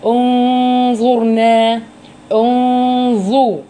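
انظرنا with a long hidden nasal ن; ikhfa with ghunna. Shown as a demonstration. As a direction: hold the nasal hum on the ن of انظرنا. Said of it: "بصوا تطبيقها".

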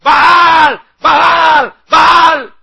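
loud, high cries to a god; frenzied shouting. Retold or imitated. Prophets crying out to their god, mimicked frenzied shout. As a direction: chant it as a forceful high yell. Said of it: "con más ímpetu gritaban".

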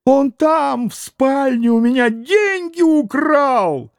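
put-on high, slow squealing old man; old man's squeal. A narrator's reading. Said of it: "завизжал Фёдор Павлович".

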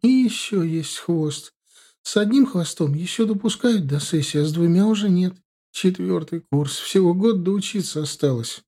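high-pitched pleading student voice; anxious explaining. A narrator's reading. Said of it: "заламывая руки, начала объяснять она".

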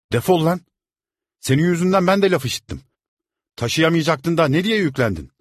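furious, high, loud yell; angry yelling. A narrator's reading. Angry servant yelling at the boy over the broken bottles. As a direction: scold him at full volume. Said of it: "Öteki hızla bağırdı".

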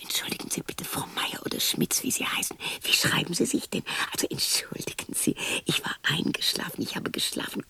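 hushed, embarrassed excuses; whispered apology. Acted. Woman apologizing to Frau Meier in a whisper.

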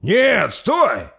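very high, loud cry of objection; desperate yelled protest; protesting shout. Acted. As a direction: shout to stop a blow. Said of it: "возразил Торстейн".

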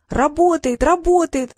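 high, excited repeated cry. A narrator's reading. Gruya sees the magic work, crying out. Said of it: "закричала Груя".

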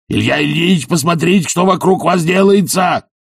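high-pitched alarmed cry; frightened voice. A narrator's reading. Servant startling master out of sleep, frightened. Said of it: "испуганным голосом заговорил он".

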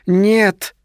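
high-pitched inner cry; deafening no. A narrator's reading. A deafening no flares in his brain, high cry. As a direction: cry it out high, a sudden jolt. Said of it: "вспыхнуло оглушительное".

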